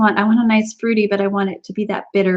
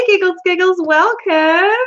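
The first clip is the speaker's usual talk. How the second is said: normal talking voice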